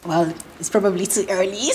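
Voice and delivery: ordinary delivery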